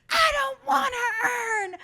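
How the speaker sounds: high, loud, drawn-out tantrum wail; mock a child's screamed refusal; imitating screaming toddler. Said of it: "Chloe just starts screaming".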